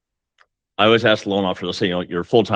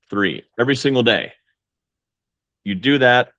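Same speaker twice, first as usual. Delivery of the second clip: ordinary talk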